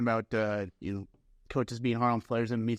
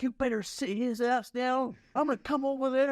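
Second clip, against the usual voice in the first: imitating an angry coach; 11 semitones higher than usual. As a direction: play the furious coach threatening from the bench. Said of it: "he's yelling at me".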